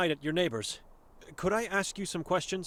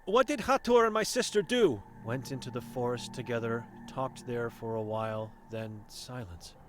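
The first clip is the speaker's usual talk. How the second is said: a hint of a stern old seer, guarded; wary old Vera sizing up a stranger; blind old woman; 8 semitones lower than usual.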